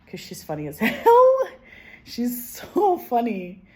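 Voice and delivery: laughing loud and high; real laughter through the words. Real, not acted. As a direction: crack up over how funny she is.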